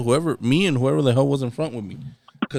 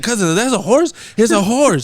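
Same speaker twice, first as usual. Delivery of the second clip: higher, louder startled "that's a horse?"; shocked quote; 6 semitones higher, 5 dB louder than usual. Retold or imitated. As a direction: blurt it like the horse just appeared. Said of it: "I was like".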